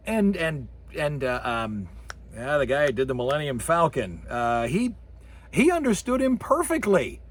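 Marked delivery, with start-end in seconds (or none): none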